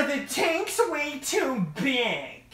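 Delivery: high, slow mock-critic voice; mocking online commenter. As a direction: drag it out in a silly high whine. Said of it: "and I simply get".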